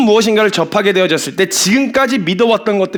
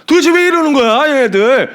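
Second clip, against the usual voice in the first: acted newcomer's protest, pitched up; mock exasperated bewilderment; 4 semitones higher than usual. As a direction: play baffled by these people, starting high. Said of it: "이런 정서 한 번씩 다 느껴보셨을". (황당해하는 척)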